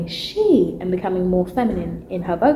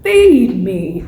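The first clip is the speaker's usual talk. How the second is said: Latrice Royale imitation; 9 dB louder than usual. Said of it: "you could really hear, like".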